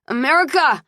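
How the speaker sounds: high, exasperated call; urgent appeal. A narrator's reading. Squirming woman appeals to her friend, urgent. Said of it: "I said, squirming".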